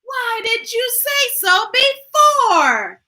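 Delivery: high, slow astonished question; exclaiming. A narrator's reading. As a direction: exclaim in disbelief. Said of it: "exclaimed the carpenter".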